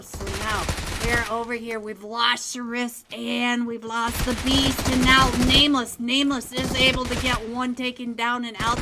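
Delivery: loud, fast hyped fight commentary; live play-by-play. Acted. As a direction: call the fight like a sportscaster.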